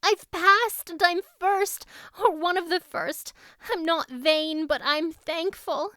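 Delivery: put-on girl telling the farmer her good news; excited young Anne. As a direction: blurt out the news, then settle thankful. Anne tells Matthew.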